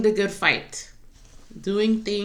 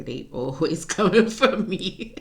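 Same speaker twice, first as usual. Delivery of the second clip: a laugh breaks into the line, a little louder; 3 dB louder than usual. Real, not acted. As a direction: laugh it off mid-sentence. Laughing at people coming for her.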